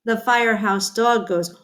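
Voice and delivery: usual speaking voice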